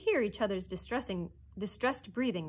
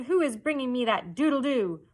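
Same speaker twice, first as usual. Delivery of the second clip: loud, raised, commanding demand; thundering; 4 semitones higher, 8 dB louder than usual. A narrator's reading. Hook demands a volunteer from his crew, thundering. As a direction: thunder the question out. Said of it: "he thundered".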